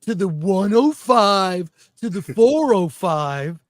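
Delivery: slow, lightly voiced Californians skit. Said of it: "Now we're gonna sound like the Californians".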